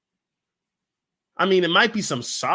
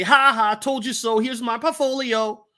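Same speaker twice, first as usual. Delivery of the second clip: higher, taunting told you so; gloating told you so; 5 semitones higher, 3 dB louder than usual. Retold or imitated. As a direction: gloat like you were right all along. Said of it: "we wanna say".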